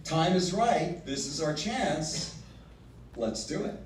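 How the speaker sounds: soft, coaxing nudge; a hint of sweet persuasion; wife coaxing her husband to go ahead. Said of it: "is she joining in and giving him that extra".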